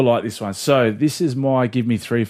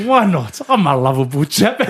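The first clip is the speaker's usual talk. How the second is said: put-on Jake the Muss accent. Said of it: "as soon as you done the Jake the Musk".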